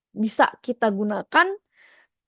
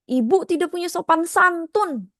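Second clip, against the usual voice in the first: judgmental scolding; 7 semitones higher than usual. Shown as a demonstration. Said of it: "Atau mungkin".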